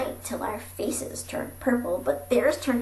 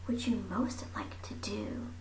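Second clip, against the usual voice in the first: soft whisper; 8 dB quieter than usual. Acted. Asking Lincoln quietly.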